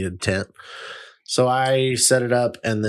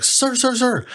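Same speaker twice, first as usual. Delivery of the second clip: high, insistent calls of sir; urgent stranger calling sir sir sir; 14 semitones higher, slower than usual. Retold or imitated. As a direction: call up to the man on the porch, pitched high.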